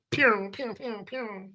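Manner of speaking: high little pew-pew shots; mock gunfire. Comic playing agent firing away, mock gunfire. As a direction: imitate toy laser shots. Imitating gunfire after Gone.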